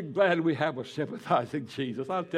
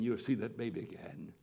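softer and lower, gently promising; soft reassurance; 4 semitones lower, 8 dB quieter, faster than usual. Retold or imitated. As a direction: say it tenderly to the grieving mother. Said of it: "And I said, "Penny".

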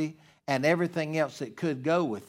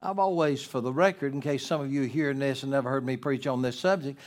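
normal talking voice

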